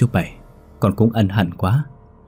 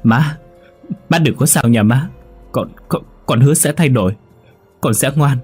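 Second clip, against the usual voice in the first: climbing higher and louder, begging through tears; sobbing plea; 8 semitones higher, 4 dB louder than usual. A narrator's reading. Đại begs his wounded mother not to die, sobbing ever higher. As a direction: promise everything to a dying mother, voice breaking. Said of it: "Anh ôm bà trong lòng mà khóc nức nở".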